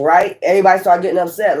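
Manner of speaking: usual speaking voice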